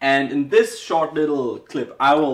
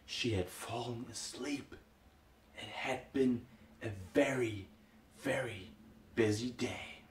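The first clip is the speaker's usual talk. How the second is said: very soft, slow bedtime ending; barely audible, low narration; hushed storytelling; 3 semitones lower, 16 dB quieter, slower than usual. A narrator's reading. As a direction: lull them to sleep with a hush. Bedtime ending, spider fallen asleep.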